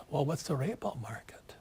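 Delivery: mock-clueless whispered question; hushed, naive imitation of the average man; clueless average guy. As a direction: whisper it like a puzzled nobody. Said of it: "And the average guy goes".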